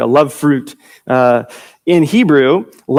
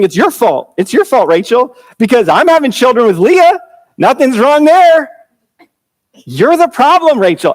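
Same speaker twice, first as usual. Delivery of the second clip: blaming Jacob; 9 semitones higher, 3 dB louder than usual. Retold or imitated. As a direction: point the finger at Rachel, mock-accusing and pitched up. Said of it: "And really, he's saying".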